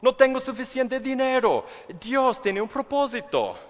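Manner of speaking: high, loud, sarcastic cheer; sing-song mock positivity; mock cheerful. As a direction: fake upbeat optimism about bad news. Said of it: "lo primero que pienso es".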